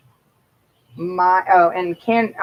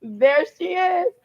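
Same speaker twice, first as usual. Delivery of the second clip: higher, cracking gasp of surprise that slows at the end; sudden high-pitched shock, then drawn out slowly; startled exclamation; 9 semitones higher, 4 dB louder, much slower than usual. Retold or imitated. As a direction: spot her suddenly and blurt it out high. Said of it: "And then you're like".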